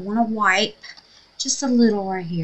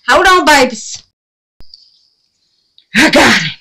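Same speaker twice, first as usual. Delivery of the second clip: much higher, loud excited cry; loud triumphant; 8 semitones higher, 15 dB louder than usual. Real, not acted. To her paints while killing the bug, loud triumph. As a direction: yell it as she swats the bug.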